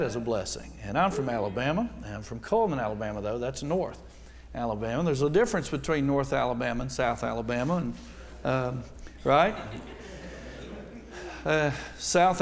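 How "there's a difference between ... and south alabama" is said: ordinary talk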